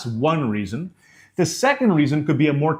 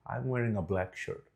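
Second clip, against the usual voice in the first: much softer, low-energy statement; lowered volume; 4 semitones lower, 13 dB quieter than usual. Shown as a demonstration. Coach shows volume following the face, very quiet and subdued. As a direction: let the volume drop right down. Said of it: "lower my volume".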